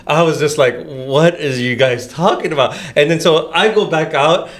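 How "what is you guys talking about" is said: slow, drawn-out incredulous question; slow disbelief. Retold or imitated. Player retelling reply to teammates, slow pointed disbelief. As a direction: spell it out slowly, word by word.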